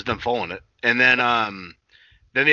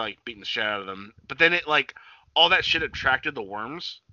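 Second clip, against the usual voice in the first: usual speaking voice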